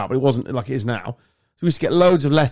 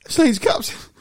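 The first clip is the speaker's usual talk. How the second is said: ordinary talk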